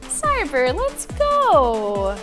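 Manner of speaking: ordinary delivery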